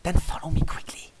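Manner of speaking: hushed, hurrying princess whisper; urgent whisper. A narrator's reading. Luna ordering you to follow quickly, in a whisper.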